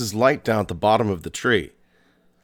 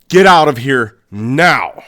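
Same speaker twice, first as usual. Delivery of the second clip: louder, urgent retold warning; 2 semitones higher, 8 dB louder than usual. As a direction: push him away with a sudden loud warning. Terrified friend warns him away.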